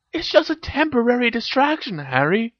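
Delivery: high-pitched breezy dismissal. A narrator's reading. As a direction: brush it off in a high girl's voice. Hermione's line, echoed by Harry.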